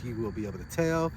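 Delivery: ordinary delivery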